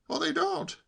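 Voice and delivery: high-pitched contradiction; know-all nephew. A narrator's reading. Nephew contradicting uncle about swallows, know-all. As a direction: correct him in a high voice.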